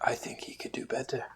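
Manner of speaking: whispered aside. Acted. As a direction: whisper it snidely. Unvoiced aside.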